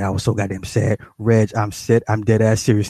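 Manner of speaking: ordinary talk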